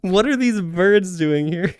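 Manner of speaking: high, loud, drawn-out mimicry; Keat Fox. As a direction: copy a streamer's exaggerated bewildered question. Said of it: "trying to do the Keat impression of the Volpe impression".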